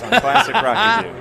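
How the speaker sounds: over-the-top radio voice plugging the station; hammed-up radio DJ. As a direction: belt it like a classic rock station ident. Repeating a radio tagline.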